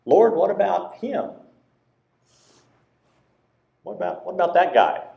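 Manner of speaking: mock-indignant "what about him"; Peter's pointed question.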